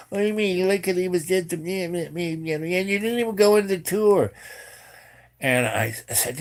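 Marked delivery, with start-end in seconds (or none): 0.1-4.3 high, running babble of mimicked complaint; mock nagging women. Girls scold him for skipping the tour, high mock nagging babble. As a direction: imitate endless nagging in a high, softened voice.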